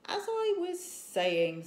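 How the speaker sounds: resume grandly, slowly; pompous chief. A narrator's reading. Stoic the Vast picks up his speech again: lightly pompous. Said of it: "said Stoic the Vast".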